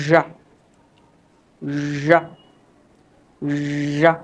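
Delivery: long buzzed zh opening "ঝা"; isolated, drawn-out zhah for "ঝা"; zh sound demo. Shown as a demonstration. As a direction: hold the zh, then release into "ঝা". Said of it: "যেমন একটু খেয়াল করো আমাকে".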